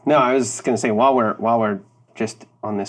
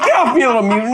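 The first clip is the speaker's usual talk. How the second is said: high, loud, laughing push-away; laughing brush-off; 10 semitones higher, 5 dB louder, much faster than usual. Retold or imitated. Player shoving off the clinging kid, laughing loudly. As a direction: shove the kid off, cracking up. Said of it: "I'm like".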